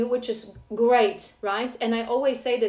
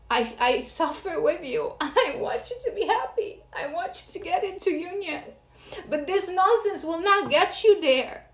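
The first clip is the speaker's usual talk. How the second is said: pleading, higher, voice catching with a laugh; getting emotional; 6 semitones higher than usual. Real, not acted. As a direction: let real emotion lift the voice as you plead. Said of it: "I'm getting emotional".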